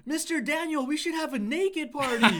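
put-on high, loud child shout, slowed and laughing; girl yells at bedtime to the babysitter, high and loud; kid yelling. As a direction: yell it out like a cheeky little girl. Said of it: "Lily yells out".

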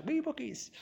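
"Wee Bookies" jumps high and soft, "Wee" lilted up then falling; a cute, squeaky "Wee Bookies" savouring the Scottish word; playful mock-Scottish twee. Shown as a demonstration. Lecturer teasing the quaint Scottish name, said high and soft. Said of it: "'cause they're Scottish".

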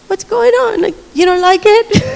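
high, startled asking; concerned surprise. Retold or imitated. Speaker asking the crying homeless man, high startled concern. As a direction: sound caught off guard by his tears.

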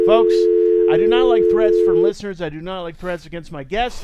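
loud, stern warning to listeners; loud scolding. Acted. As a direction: firmly tell listeners off. Host reacts loudly to threat.

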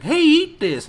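high, loud imitated urging; hammed-up food pusher; mock pushy offer. Imagined person pushing food at him.

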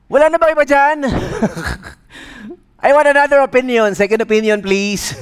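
high, loud sceptical plea; joking demand; comic doubt. Retold or imitated. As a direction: ask for a second opinion. Jogger doubts God.

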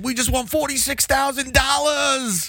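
hammed-up New York accent. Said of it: "in your fucking New York accent".

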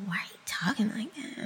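whispered scolding mom question; half-whispered imitation of mom; mom's hushed quote. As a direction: breathe it like a disapproving mom. Mom scolding her way of talking.

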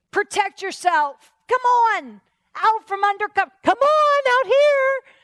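high, slow, drawn-out urging; goading tempter. Acted. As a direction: egg them on, building to a loud high call. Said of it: "The enemy's whispering".

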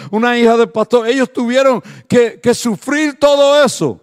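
loud, high, building preacher cry; preaching at full force. Acted. Pastor to the congregation about his children, preaching at full force. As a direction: pour out the hurt his children bore.

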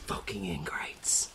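breathy muttered grumble under the breath; whispered aside. Acted. Host grumbling at ungrateful imaginary listeners in a whisper. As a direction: whisper it bitterly to yourself.

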